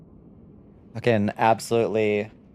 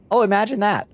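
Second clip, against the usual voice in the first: much higher, sarcastic "Oh, imagine that"; mock surprise; 9 semitones higher than usual. Real, not acted. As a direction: fake astonishment, dripping with sarcasm. Sarcasm about liars repeated.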